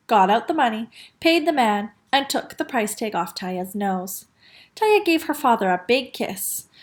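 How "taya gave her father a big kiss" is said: usual speaking voice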